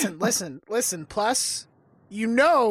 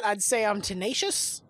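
ordinary talk

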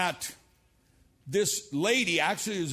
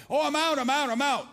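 excited call; 10 semitones higher, 5 dB louder, faster than usual. Retold or imitated. Campers calling out in maze.

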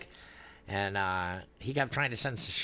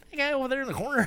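surprised; 4 dB louder, much faster than usual. Retold or imitated. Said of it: "And I'm like".

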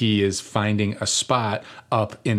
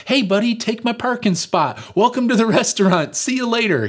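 high, louder, sing-song fake cheer; sarcastic mock friendliness; 13 semitones higher, 4 dB louder than usual. Mocking what the wronged driver surely did not say, high fake cheer. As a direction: play the angry driver as a sickly sweet host. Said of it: "I'm fairly confident it was not like".

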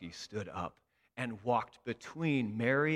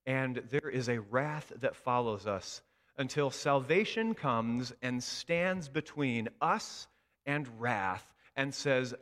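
ordinary talk

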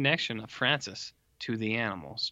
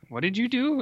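shocked question; 11 semitones higher than usual. Retold or imitated. Francis shocked at the deed.

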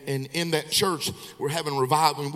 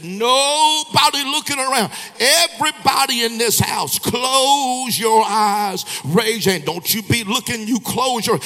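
much higher and louder, calling over the whole house; commanding shout; 6 semitones higher, 4 dB louder than usual. Retold or imitated. Preacher ordering the congregation to shut their eyes, commanding shout. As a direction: bark orders to close every eye.

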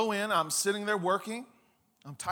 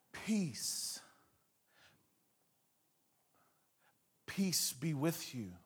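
much softer, slow soothing murmur; calming voice; 2 semitones lower, 7 dB quieter, much slower than usual. Acted. Jesus comforting terrified disciples, soft and slow. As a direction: ease their fear with each word. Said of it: "That's a calming voice".

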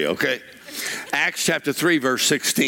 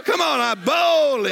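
ordinary talk, nothing marked

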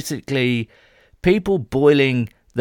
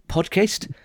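put-on playful South African accent on "podcast", pitch raised; a quick jokey South African twang; 6 semitones higher than usual. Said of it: "I went to be South African then".

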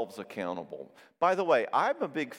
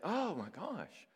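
mock dismay; 5 semitones higher, 8 dB quieter, slower than usual. Counselor reacting to woman's story.

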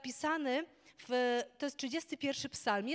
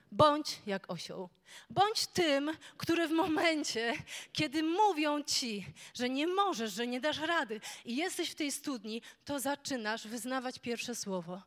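louder, higher, building fervent preaching; preaching at full force; 6 semitones higher, 12 dB louder than usual. Acted. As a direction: rouse the church to climb out. Preaching climax to congregation. (żarliwe, głośne kazanie)

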